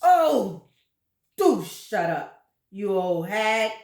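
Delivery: loud dismissive growl; loud put-on grumpy old man; grumpy husband. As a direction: brush off your wife with contempt. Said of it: "Mister Twit said".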